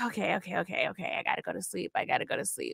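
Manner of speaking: calming herself after the panic spiral, very softly; soft self-soothing. Acted. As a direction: talk yourself down in a near whisper.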